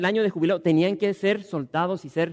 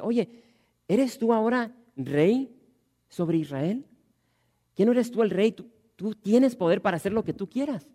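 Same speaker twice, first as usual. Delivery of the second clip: preacher retelling the wife mocking the king, mildly sarcastic; sarcastic mockery; 4 semitones higher than usual. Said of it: "se mofa de él de una manera sarcástica".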